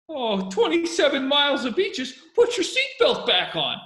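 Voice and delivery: high, loud, slow mock tour-guide cheer; imitating the oblivious kid. Podcaster imitating the clueless kid pilot, hammed-up and cheery. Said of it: "sitting there thinking, like".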